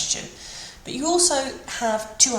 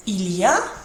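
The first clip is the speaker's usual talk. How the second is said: "Il y a" with the final "a" lifted; rising question intonation; 6 dB louder than usual. Shown as a demonstration. Teacher recapping the first option, rising end on "Il y a". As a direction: turn "Il y a" into a question, voice climbing at the end. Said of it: "and voice up at the end".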